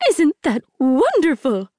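high, bright gushing question; beaming. A narrator's reading. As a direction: gush with a big smile. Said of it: "Matchmaker Liu beamed".

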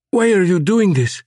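high, loud panicked cry; strapped woman screaming at her captor in panic. Acted. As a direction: scream it in terror. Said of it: "Gessner had screamed in panic".

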